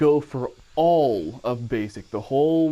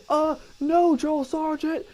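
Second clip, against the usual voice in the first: barked military recruit reply; 13 semitones higher, slower than usual. Retold or imitated. Recruit answering drill sergeant.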